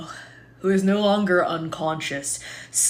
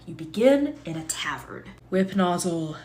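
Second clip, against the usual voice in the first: normal talking voice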